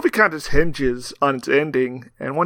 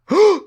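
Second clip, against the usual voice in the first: mock gasp; 7 dB louder than usual. Mock shock at podcast joke.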